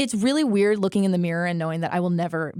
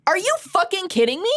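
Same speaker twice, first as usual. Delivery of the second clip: outraged disbelief; 12 semitones higher than usual. Retold or imitated. Retelling her reaction to mom about her grandfather, high outraged cry. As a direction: hit it like a betrayed kid. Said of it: "And I was like".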